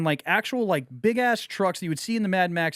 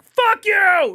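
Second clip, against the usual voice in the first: high, loud war-party holler; yelled greeting; 14 semitones higher, 7 dB louder than usual. Retold or imitated. Spear-waving truck riders greeting passers-by, loud holler. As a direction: yell it hanging off a speeding truck. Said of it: "they're like, they got spears".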